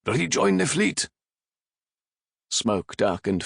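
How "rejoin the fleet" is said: a captain yelling to his crew; shouted order. Acted. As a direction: bellow the order across the deck. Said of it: "Raffe shouted".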